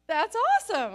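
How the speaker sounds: high, loud, thrilled that's awesome; excited mom. Retold or imitated. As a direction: cheer her on, thrilled. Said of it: "And I'm just like".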